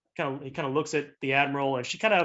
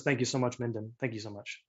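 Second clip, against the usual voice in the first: low, softly voiced gratitude; soft thanks; 3 semitones lower, 7 dB quieter than usual. Admiral turns to Minden to thank them, soft and low. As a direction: thank them quietly, trailing softer.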